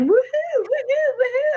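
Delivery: high-pitched mock woohoos; singsong imitation whoops, way up high; imitated cheering. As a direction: mimic revellers whooping on the bed. Retold cheering of women on bed.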